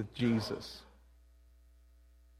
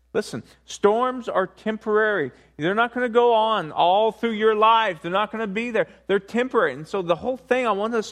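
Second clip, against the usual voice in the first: raised, fervent preaching voice; 4 semitones higher, 3 dB louder than usual. Acted. Pastor to congregation, preaching with rising force. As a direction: drive the point home to the congregation.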